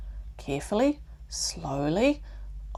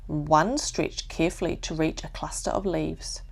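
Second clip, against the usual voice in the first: ordinary delivery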